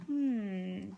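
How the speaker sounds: plain reading voice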